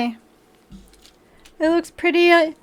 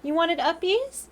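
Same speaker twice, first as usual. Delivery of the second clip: higher, softer cooing question; 4 semitones higher, 7 dB quieter than usual. Real, not acted. Talking to her cat about uppies, soft high cooing. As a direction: coo sweetly at the cat.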